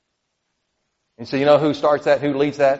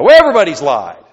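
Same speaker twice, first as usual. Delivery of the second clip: dismissive excuse; 4 semitones higher, 8 dB louder than usual. Retold or imitated. Imitating people minimizing sin.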